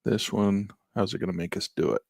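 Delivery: normal talking voice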